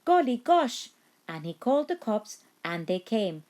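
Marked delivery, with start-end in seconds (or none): 0.1-0.9 high, loud startled exclaim; surprised farmer. A narrator's reading. The farmer wakes to the noise, loud surprise. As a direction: jolt awake surprised. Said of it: "the farmer woke up and said".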